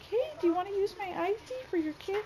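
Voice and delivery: high-pitched old-lady impression; mock sweet old neighbor voice; imitated old lady. Imitates old lady's offer.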